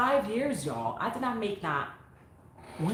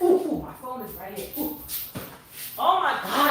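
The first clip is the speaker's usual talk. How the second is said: ordinary delivery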